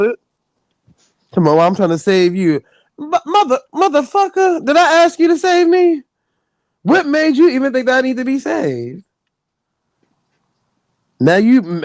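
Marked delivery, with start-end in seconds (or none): 3.0-9.0 higher pitched, exasperated comeback; indignant retort. Retold or imitated. Host answering a religious preacher, higher exasperated retort. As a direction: throw it back at them, offended.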